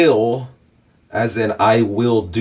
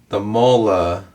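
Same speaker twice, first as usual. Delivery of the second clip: ordinary talk, nothing marked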